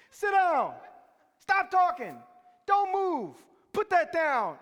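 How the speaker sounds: loud, high barked commands; screaming orders. Retold or imitated. Teachers screaming at the cafeteria, loud and high. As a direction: scream them into silence. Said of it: "just screaming, top of their lungs".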